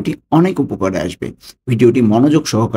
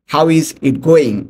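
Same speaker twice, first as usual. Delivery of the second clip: slow; 5 semitones higher, much slower than usual. Shown as a demonstration. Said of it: "ইংরেজিতে".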